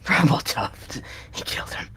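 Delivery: breathy whisper, losing it; dying villain whispers, mad; unhinged whisper. Acted. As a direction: whisper it like a secret, deranged. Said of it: "clearly losing it".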